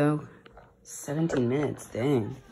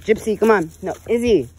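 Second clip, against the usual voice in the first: 1 shouting at dogs; 7 semitones higher, 13 dB louder than usual. Real, not acted. Dogs running off on walk.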